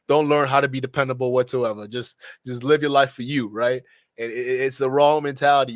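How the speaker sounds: ordinary talk, nothing marked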